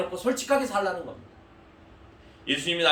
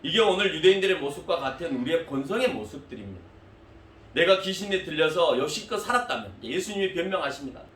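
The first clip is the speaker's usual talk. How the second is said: ordinary talk, nothing marked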